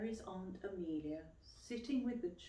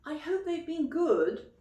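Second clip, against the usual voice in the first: lightly voiced mum, higher and louder; a mother checking up, brightly; Mum asking; 10 semitones higher, 10 dB louder than usual. A narrator's reading. Mum asks Aunt Amelia about the children, lightly voiced. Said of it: "asked Mum".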